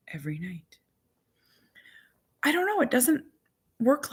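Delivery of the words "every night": 1 whisper. Real, not acted. Trailing whisper.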